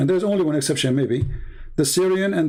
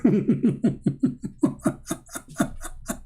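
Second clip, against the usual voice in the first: long, breathy cackling laugh; real laughter; 5 semitones higher, 4 dB quieter than usual. Real, not acted. Speaker mocks the idea of disappearing in Israel, real long laughter. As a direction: crack up and keep laughing.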